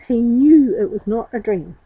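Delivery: ordinary delivery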